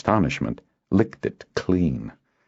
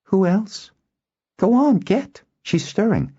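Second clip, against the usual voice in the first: put-on much higher girlish voice, brisk orders; bossy girl. Girl sends Kindon for breakfast, bossy. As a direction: play a bossy girl shooing someone off. Girl bossing the boy about.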